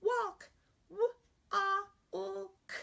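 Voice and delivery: put-on high, childlike letter-by-letter; teacher playing the student who sounds out each letter; child spelling aloud. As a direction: spell it like a young pupil. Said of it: "Sound it out for me again".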